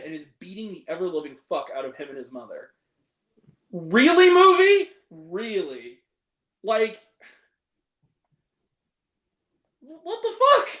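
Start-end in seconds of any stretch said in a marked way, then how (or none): 3.7-10.7 loud, high incredulous "really, movie?"; exasperated disbelief. Real, not acted. Ranting at the movie, loud exasperated disbelief. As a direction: throw it up in disbelief, louder and higher.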